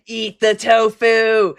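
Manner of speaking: slow, commanding villain voice; hammed-up evil command; mad scientist. Villain commanding viewer to eat.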